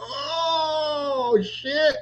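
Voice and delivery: high startled oh, shit; a real jolt of shock; shocked cry. Real, not acted. Scene: host seeing the video, shocked cry.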